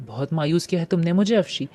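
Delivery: plain reading voice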